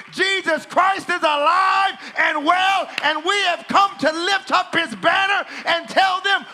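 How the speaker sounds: loud, high, drawn-out shouted sermon; full-force preaching. Real, not acted. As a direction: cry it out like a rallying call.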